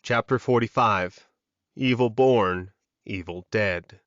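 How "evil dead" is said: ordinary talk, nothing marked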